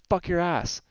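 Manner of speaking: imitating a sudden high aggressive blurt; streamer's aggressive tic imitated, high and abrupt. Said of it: "there's an aggressive tone to some of her tics".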